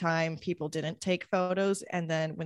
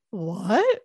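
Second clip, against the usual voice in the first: shocked what; 3 semitones higher, 7 dB louder than usual. Retold or imitated. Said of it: "I was like".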